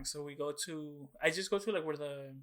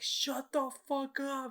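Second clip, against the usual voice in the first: much higher, slow, elated; excited disbelief; 11 semitones higher, much slower than usual. Retold or imitated. As the bootleg starts playing, high slow elated disbelief. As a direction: stretch it out in thrilled disbelief. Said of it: "I was like".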